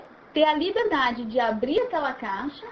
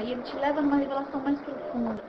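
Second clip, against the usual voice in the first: ordinary talk, nothing marked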